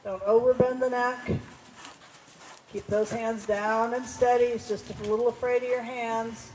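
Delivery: loud, carrying riding instructions; calling across arena. Real, not acted. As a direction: project commands across the arena.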